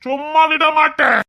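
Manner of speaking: high, slow, drawn-out holler; hammed-up angry bluster; drunken threat. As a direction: holler a threat, dragging each word. Drunk man threatens loudly.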